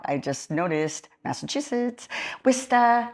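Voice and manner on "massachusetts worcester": Worcester said the Massachusetts way; Worcester accent. Shown as a demonstration. Diver demoing the local Massachusetts accent. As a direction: drop the r in Worcester, Massachusetts style. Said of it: "that's how they talk".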